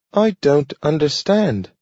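a confused little dog asking for help; pleading dog. A narrator's reading. Dog Nathaniel pleading with Grant, high and puzzled. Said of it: "he pleaded".

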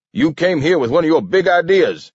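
high, angry, contained shout. A narrator's reading. King accusing Webster before the council, shouting. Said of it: "shouted King".

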